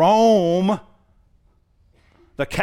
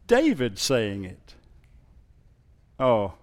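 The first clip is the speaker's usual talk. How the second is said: usual speaking voice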